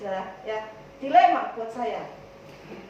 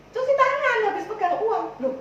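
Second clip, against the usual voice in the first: high, insistent mock instruction; acted exasperated repeating, pitched way up; hammered-home explaining; 9 semitones higher than usual. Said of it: "Kalau ngomong sama Bu Sukiran itu harus".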